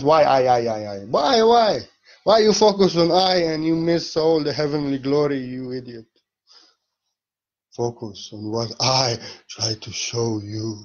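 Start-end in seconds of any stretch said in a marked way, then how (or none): 0.0-6.1 higher, louder pestering then scolding; hammed-up exasperated scolding; mock exasperation. Speaker mocking people who fixate on a word, exasperated. Mocks questioners, scolds them back.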